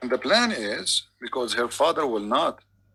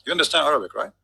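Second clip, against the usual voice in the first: ordinary delivery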